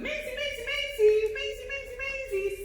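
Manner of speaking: high-pitched sing-song name calls; lilting high dog call. Real, not acted. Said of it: "High voice".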